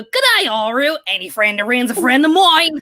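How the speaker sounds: broad fake Australian accent; hammed-up Aussie accent. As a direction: imitate an American dub actor doing broad Aussie. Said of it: "refined accent to".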